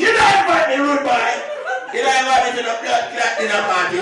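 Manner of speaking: loud Jamaican patois yell; a furious Jamaican dad complaining he wasn't invited; Jamaican dad shouting. Retold or imitated. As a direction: bark it at him like an angry Jamaican dad. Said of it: "Jamaican dad yelling".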